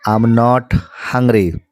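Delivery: I'm not hungry, word by word, very slowly; very slow drill. Shown as a demonstration. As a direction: drag each word for the class. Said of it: "five times बोलना है". (बहुत धीरे बोलकर दिखाना)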